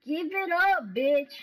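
real trash-talk shout; loud taunt. Real, not acted. Taunting opponent in game.

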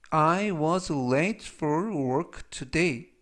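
very slow, word-by-word English model; slow, deliberate teaching read; language-lesson model reading. Shown as a demonstration. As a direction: read it out slowly for learners to repeat.

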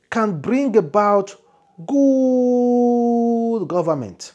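high, loud, grand proclaiming voice; voice from above. Acted. The angel's voice proclaiming, high and loud like a sermon. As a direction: preach it like a prophecy. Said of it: "voice said to me".

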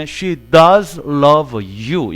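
"does" stretched and stressed with a falling tune; emphatic does. Shown as a demonstration. Teacher demonstrating emphatic do, very slowly. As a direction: draw "She does love you" out very slowly, leaning on "does". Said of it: "강조의 do는 강세가 있다".

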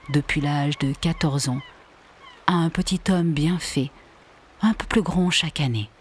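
usual speaking voice

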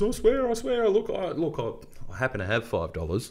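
put-on high, flustered kid voice, settling down; panicky excuse. Caught bully pleading with the adults, high and flustered. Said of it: "and goes".

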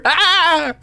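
high-pitched mock scream. Imagining the hit kid, mock scream. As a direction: let out a pretend high yelp. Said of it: "screams with a stutter".

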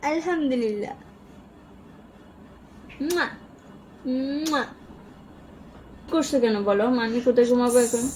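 ordinary delivery

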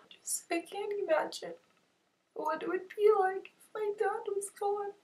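very soft, high-pitched, choked-up voice; tearful. Real, not acted. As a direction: barely get it out through real tears. Tearful moment, close to crying.

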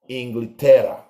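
ordinary talk, nothing marked

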